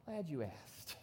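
knowing calm reply. Retold or imitated. Turns a slow turn right around.

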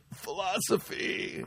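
soft, strained whisper through held breath; holding in smoke. Retold or imitated. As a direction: squeeze the word out slowly after a hit. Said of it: "He takes a hit".

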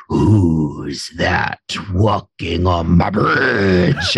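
slow, drawn-out troll growl; storybook troll asking who crosses the bridge. Shown as a demonstration. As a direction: loom over the kids as the troll. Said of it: "make the troll sound".

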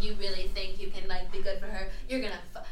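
low, taunting imitation of a schemer; mock villainess. Villain emerges from shadow, threatening.

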